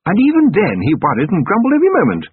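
a fond, brisk noblewoman; Lady Sybil voice. Acted.

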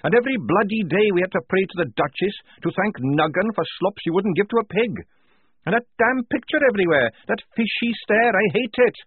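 high, loud, indignant tirade; angry rant. Acted. Tonka railing against praying to the Duchess, furious. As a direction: rail bitterly, pitch held way up.

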